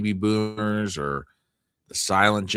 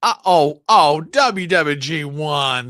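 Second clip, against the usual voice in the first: loud startled gasp into a name; shocked; 7 semitones higher, 9 dB louder, slower than usual. Real, not acted. Streamer reading a viewer's name, shocked. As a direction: caught off guard, voice jumps up.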